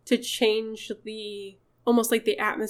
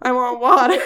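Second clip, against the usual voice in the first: louder mock demand breaking into laughter; teasing imitation; 3 semitones higher, 8 dB louder than usual. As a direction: playfully mimic someone demanding things. Mock quote teasing Charlie's wishes.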